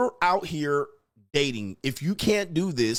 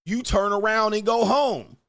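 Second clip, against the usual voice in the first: shout; 8 semitones higher, 4 dB louder than usual. Real, not acted. Shouted punchline about flaking date.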